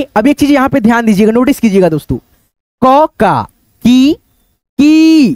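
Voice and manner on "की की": की की drawn out very slowly, higher; stretched vowel. Shown as a demonstration. Teacher telling students to notice the stretched voice, long ee. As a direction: pull की out into a long ee. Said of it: "यहाँ खींच रहा है दोस्तों अपनी आवाज को".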